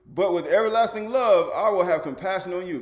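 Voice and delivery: full-voice pulpit cry; loud proclamation. Acted. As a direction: shout the promise out. Preacher proclaiming scripture.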